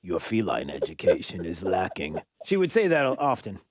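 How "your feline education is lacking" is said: much lower-pitched imitation of mom; mock-reproach in a mother's low voice. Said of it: "my mother used to say that to me".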